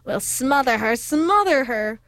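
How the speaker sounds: usual speaking voice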